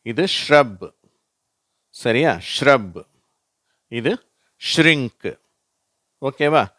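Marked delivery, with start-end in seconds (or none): 0.5-1.0 ஷ்ரப் with sh and light r merged; SHR blend demo. Shown as a demonstration. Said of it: "இதை நான் வாசிக்கிறேன்". 2.6-3.0 ஷ்ரப் with sh running into r; emphatic isolated model word ஷ்ரப்; SHR blend demo. Shown as a demonstration. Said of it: "இதை நான் வாசிக்கிறேன்". 4.8-5.4 ஷ்ரிங்க் raised, sh and r merged; SHR blend demo. Shown as a demonstration. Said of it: "அப்படியே merge பண்ணி".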